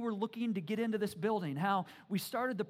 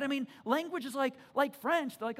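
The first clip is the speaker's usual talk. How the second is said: usual speaking voice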